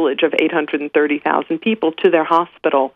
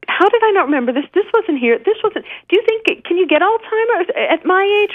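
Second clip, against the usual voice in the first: mock bewildered; 13 semitones higher than usual. Asking husband, bewildered in China.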